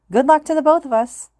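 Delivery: put-on high, bright girl calling out; girl's voice. A narrator's reading. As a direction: call it out cheerfully as a girl. Girl waves goodbye.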